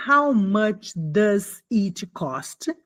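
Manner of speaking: How much does it cost? drawn out very slowly; slow model phrase. Shown as a demonstration. Teacher modelling a shop question, very slow. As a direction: lay out each word for learners. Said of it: "você pergunta".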